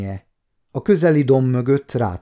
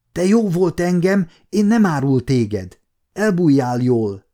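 put-on higher-pitched young foreigner in broken Hungarian; young Italian; 5 semitones higher, 3 dB louder than usual. A narrator's reading. Italian boy repaying Gergely's mercy, in a raised character voice. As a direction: voice an Italian lad urging someone to hide. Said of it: "A fiatal olasz volt".

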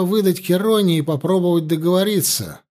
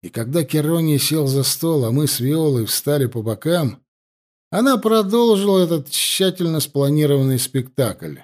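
ordinary delivery, nothing marked

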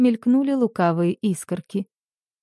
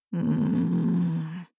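hum; 2 semitones lower, 5 dB quieter than usual. A narrator's reading. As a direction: hum through closed lips. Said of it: "промычал муж".

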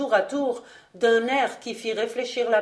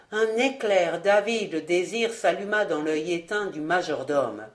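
ordinary delivery, nothing marked

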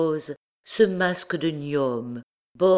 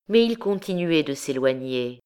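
plain reading voice

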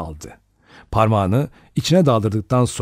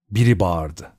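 plain reading voice

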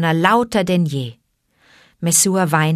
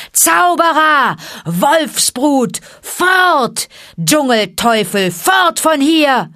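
much higher, louder furious yells; angry mob shouting; 8 semitones higher, 5 dB louder, slower than usual. Acted. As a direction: hurl curses like a mob throwing stones. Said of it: "die Menge schrie". (wütendes Schreien der Menge, viel höher und lauter)

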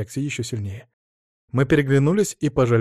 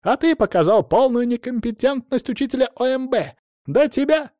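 indignant squeaky man; 12 semitones higher than usual. Acted. Adamych accuses the teacher.

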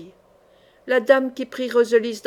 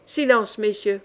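usual speaking voice

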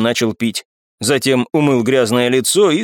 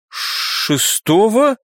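slow, high, stunned question; drawn-out horrified disbelief; shocked; 8 semitones higher, much slower than usual. A narrator's reading. Young man realising his fatal mistake, shocked. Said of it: "побледнел парень".

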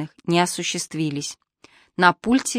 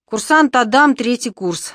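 offended report; 5 semitones higher, 4 dB louder than usual. Acted. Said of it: "с некоторой обидой доложил".

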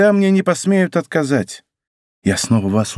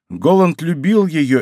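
ordinary delivery, nothing marked

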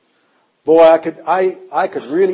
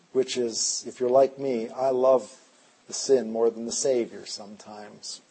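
usual speaking voice

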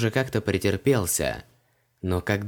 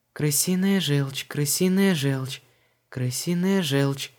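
slow, higher sing-song repetition; searching, repetitive murmur; 4 semitones higher, slower than usual. A narrator's reading. Heroine searching the shelves, slow absent-minded repetition. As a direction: repeat slowly while scanning labels. Searching labels, repeating the item.

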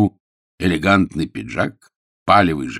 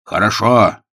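louder, emphatic hypnotic command; distinct; 3 semitones higher, 7 dB louder than usual. A narrator's reading. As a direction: press the word home loudly. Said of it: "отчётливо произнёс он".

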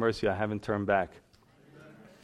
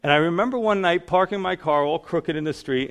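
ordinary talk, nothing marked

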